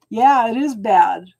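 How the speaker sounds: ordinary talk, nothing marked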